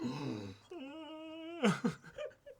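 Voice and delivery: sharp high gasp of delight; excited gasp. Real, not acted. Unboxing a big figure.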